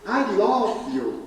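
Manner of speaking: drawn-out English, stretched very slow; slow showy English. Shown as a demonstration. Speaker repeats it to the couple, drawn out slowly in English. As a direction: linger on each word as if proud of your English. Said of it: "para a impressão que eu falo inglês".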